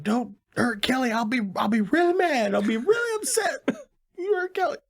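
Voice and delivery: high, whiny plea that cracks into a mock cry; whiny pleading. Begging the kidnapper on the phone, high mock whimper. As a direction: beg in a squeaky, near-tearful voice. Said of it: "So he's like".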